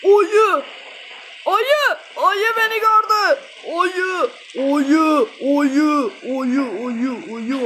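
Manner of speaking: yüksek sesle panikle bağır, giderek sönen; ayıdan kaçarken çığlık at. Real, not acted. Bear spotted, panicked shouting. (ayı görünce panikle bağırış)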